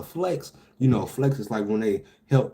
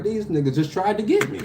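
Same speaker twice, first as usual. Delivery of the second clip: retold alarm; 8 semitones higher than usual. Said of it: "I'm like".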